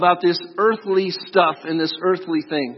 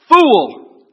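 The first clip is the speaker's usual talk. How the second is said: a sudden loud shout of the one word; yelled; 7 semitones higher, 11 dB louder than usual. Acted. God rebukes the rich man, yelling "Fool!". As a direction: bark the word out as God's rebuke. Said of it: "so I thought I should yell that part".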